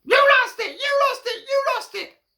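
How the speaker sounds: very high, loud shrill yowls; blaming cats. Acted. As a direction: cats yelling the blame at a mouse. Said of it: "does the same thing".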